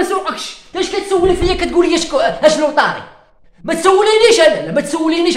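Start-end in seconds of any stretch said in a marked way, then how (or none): 3.6-4.7 mock angry shout. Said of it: "انا معصب انا دركا".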